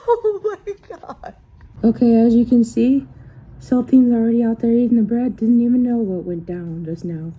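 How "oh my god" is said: slow, higher, laughing through it; laughing in shock. Real, not acted. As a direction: let the shock spill out as a laugh. Relief after the snake scare.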